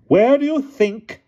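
put-on slow, loud, imperious old lady. A narrator's reading. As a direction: speak like a commanding elderly aunt.

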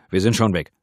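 loud, reassuring shout; calling back. A narrator's reading. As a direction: shout it so he hears you leaving. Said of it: "rief der Kommissaris zurück".

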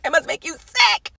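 goading repeat; taunting emphasis. Real, not acted. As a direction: rub it in slowly. Taunting repetition.

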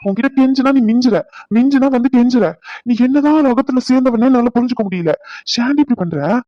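high, loud outburst; exasperated shout. Acted. Husband shouting his frustration at wife. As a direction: burst out at her.

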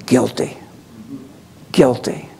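low, solemn verdict; pronouncing voice. Retold or imitated. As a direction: pass sentence in a deep, grave tone. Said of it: "the voice comes forth and says".

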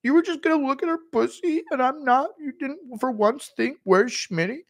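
high offended whine; Schmitty impression. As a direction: squeak it, hurt and left out. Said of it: "Schmitty not invited".

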